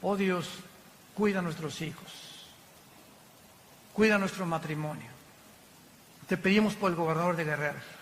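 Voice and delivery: low, subdued plea to God; prayerful pleading. Acted. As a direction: pray it softly and humbly. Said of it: "diciéndole a Dios".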